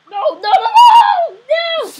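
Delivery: loud high repeated no; loud high frantic no; panicked shouting. Real, not acted. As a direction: shout in alarm as enemies break in. Base overrun in game.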